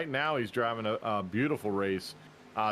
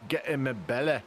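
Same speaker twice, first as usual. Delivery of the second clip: put-on Scottish accent. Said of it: "work on your Scottish accent".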